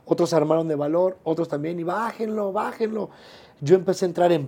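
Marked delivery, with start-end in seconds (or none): 1.8-3.1 grito fingido, agudo y bajito; abucheo imitado. Retold or imitated. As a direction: imita al público pidiendo que lo bajen. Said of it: "empezaron a gritar uno". (abucheo a media voz, en tono alto)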